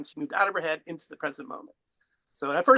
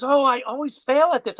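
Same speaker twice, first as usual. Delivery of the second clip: higher, louder self-reproach; mock dismay; 9 semitones higher, 7 dB louder than usual. Woman admitting failure to her beach companion, mock dismay. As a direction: imitate someone exasperated with themselves.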